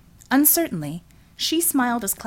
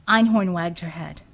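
ordinary delivery, nothing marked

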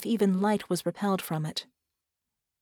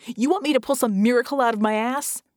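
higher, louder incredulous retort; exasperated yell; 4 semitones higher, 5 dB louder than usual. Acted. Red retorts to Vicky's demand, exasperated yelling. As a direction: yell back in sarcastic desperation. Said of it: "Red screamed".

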